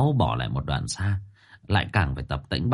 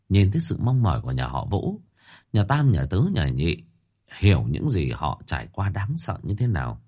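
usual speaking voice

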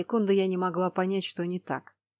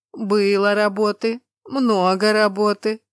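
slow, louder rumbling voice; slow giant voice; 7 dB louder, slower than usual. Acted. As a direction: rumble it out slowly. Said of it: "покивал он".

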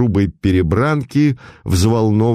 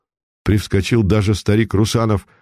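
ordinary delivery

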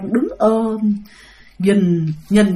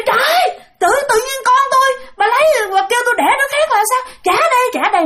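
put-on high, fast outraged mother demanding her child back; indignant scolding; 12 semitones higher than usual. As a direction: scold the ghost and snatch the baby back. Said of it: "mẹ bắt đầu chửi". (giọng mẹ tức giận mắng, đòi con)

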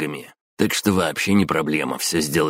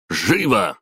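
shouting; 4 semitones higher, 3 dB louder than usual. A narrator's reading. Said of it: "хрипло выкрикнул он".

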